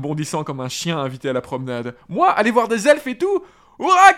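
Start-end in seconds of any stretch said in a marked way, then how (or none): 2.1-4.1 high, loud joyful shout; Sam cheering. A narrator's reading. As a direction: burst out with delight. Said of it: "cria-t-il". (Sam crie de joie)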